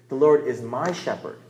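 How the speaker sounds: "my" picked out as the key word; stress on "my". Shown as a demonstration. Preacher making the verse personal, stress on "my". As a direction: lean firmly on "my" before "shepherd". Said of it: "you emphasize different words".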